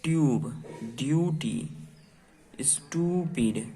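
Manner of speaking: each word said clearly with long pauses; teacher reading long u words slowly. Shown as a demonstration. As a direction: read the words for the class to copy. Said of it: "यू के sound पर से".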